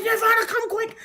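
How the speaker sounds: high-pitched acted summons; reenacted excited urgency; urgent calling. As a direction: call someone over in a hurry. Urgently calling her daughter over.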